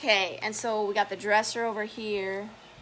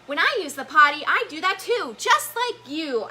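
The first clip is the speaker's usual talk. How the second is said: higher, louder little character voice; Albie's voice; 5 semitones higher, 6 dB louder than usual. Acted. Albie telling Elmo about the potty, high child character voice. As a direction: pipe up like an eager young character. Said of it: "Albie says".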